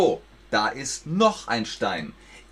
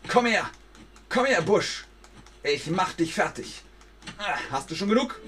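high, hammed-up fighting taunt; trash-talk the bush like a wrestler; wrestling promo; 7 semitones higher, faster than usual.

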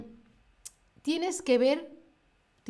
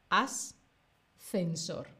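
"Ascensor" very slow and soft, each sound separate; calm and slow; 3 semitones lower, 3 dB quieter, much slower than usual. Shown as a demonstration. Teacher calms the learner, showing the slow relaxed way. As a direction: say "Ascensor" calmly, no stress, sound by sound.

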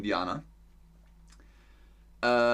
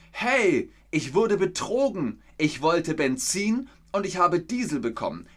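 high, loud indignant cry; mock outrage at being cheated; outraged complaint; 6 semitones higher, 4 dB louder than usual. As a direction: act cheated and furious.

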